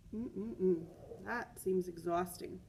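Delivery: plain reading voice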